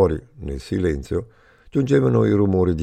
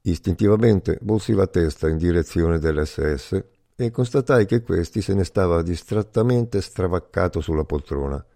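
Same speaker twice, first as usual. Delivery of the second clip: ordinary delivery, nothing marked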